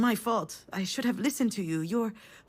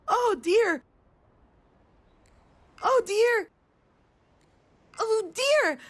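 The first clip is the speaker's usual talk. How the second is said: put-on much higher, louder little-kid wail of Oh, dear; imitated toddler screaming; 12 semitones higher, 5 dB louder, slower than usual. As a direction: squeal it like a scared small child.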